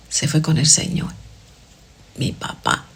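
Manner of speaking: whispered softly, moved; a soft whisper for her late mother; tender whisper. Real, not acted. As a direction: hush it out with reverence. Mother's death recalled.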